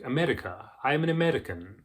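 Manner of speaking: put-on old posh British accent with tapped R; posh trilled-R accent. Shown as a demonstration. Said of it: "posh way that they trill their R's".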